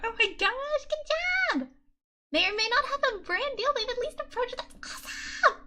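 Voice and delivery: high and loud gushing praise; excited cheering. Real, not acted. Streamer gushing over viewers' news, excited. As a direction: cheer them on like a proud friend. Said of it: "I'm getting so excited for you guys".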